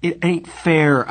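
high, slow, wounded protest; passionate, drawn-out complaint; passionate cry. A narrator's reading. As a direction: cry out against the injustice. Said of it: "I cried passionately".

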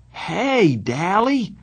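high-pitched, delighted-surprise hail; surprised greeting. Acted. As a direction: greet a friend you never expected. Narrator greets friend who suddenly appears.

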